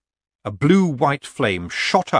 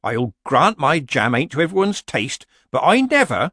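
mock-modest protest, rising in pitch; modest little tone; 6 semitones higher, 4 dB louder than usual. Granny begins defending herself to Missus Earwig, modestly. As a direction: plead modesty, voice climbing. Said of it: "in a modest little tone".

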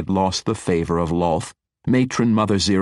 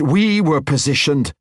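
higher, louder, interrupting fix; cutting correction; 9 semitones higher, 5 dB louder than usual. A narrator's reading. Matron correcting the wizard's pride, cutting. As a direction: snap him back in line. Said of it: "Zirith interrupted".

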